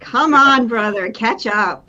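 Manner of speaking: loud, teasing call to a brother; playful taunt. Acted.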